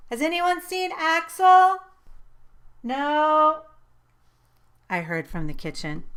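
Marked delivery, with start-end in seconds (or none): 0.1-1.8 loud, high call through the house; yelled. A narrator's reading. Calling from the front door for the dog, loud and high. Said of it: "I opened the front door and yelled". 2.8-3.7 short loud reply from another room; called back. A narrator's reading. Family member answering from the kitchen, loud and high.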